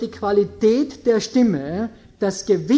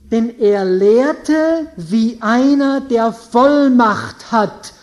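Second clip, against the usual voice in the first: very slow, louder reading of the verse; solemn scripture reading; 8 dB louder, slower than usual. Acted. Preacher reads Matthew to congregation, slow solemn reading. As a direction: weigh each word of scripture.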